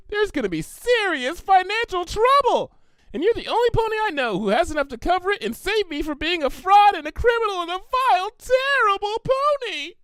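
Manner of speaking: high, breathless pleading pony voice; desperate Pinkie. Acted. Pinkie begging Twilight for help, high and breathless.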